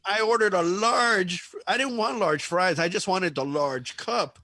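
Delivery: high, emphatic complaint, stretching "large"; retold exasperated protest. Customer protesting the tiny cup to the lady, exasperated.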